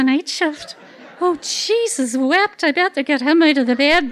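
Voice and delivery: laughing through the words; giggling while telling it. Real, not acted. Laughing at memory of waking husband.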